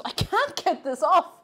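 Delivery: pushing the words out with effort; opening a stuck jar. Real, not acted. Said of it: "I'm exhausted".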